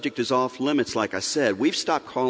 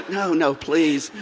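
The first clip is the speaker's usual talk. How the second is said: slow, higher mock plea, hammed-up; mock pleading son; 5 semitones higher, much slower than usual. Father acting out his son begging mom not to ask, slow mock plea. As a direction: beg the wife off, dragged out slowly. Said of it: "And I was like".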